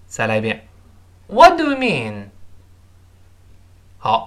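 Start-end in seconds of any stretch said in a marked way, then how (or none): 1.3-2.3 "What do you mean" starting high and falling steeply; falling question tune. Shown as a demonstration. Teacher models a wh-question for students, "What do you mean" with falling tune. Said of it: "一定要降调".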